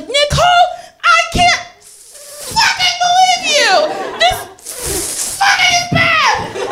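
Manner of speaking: meek sister shrieking at Nicole over the wrecked van; furious shriek. Retold or imitated. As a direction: sister losing it, shrieking every word. Meek sister explodes at her.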